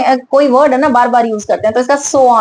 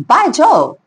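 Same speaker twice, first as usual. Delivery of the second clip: lightly hammed-up joyful father; joyfully; much slower than usual. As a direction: burst out in delight at the news. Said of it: "चुबुकव joyfully".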